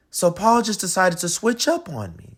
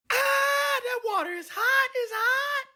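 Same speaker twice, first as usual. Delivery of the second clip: much higher squealing voice; yelping at hot water; 6 dB quieter than usual. Acted. Alexander in a too-hot bath, high squeal.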